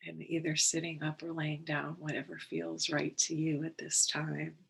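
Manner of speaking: unhurried, calm and measured, settling them in; guided meditation. Real, not acted. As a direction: guide listeners gently into the breath, leaving space between phrases.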